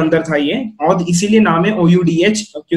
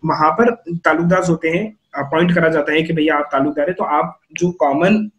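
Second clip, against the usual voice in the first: ordinary delivery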